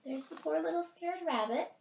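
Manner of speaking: ordinary delivery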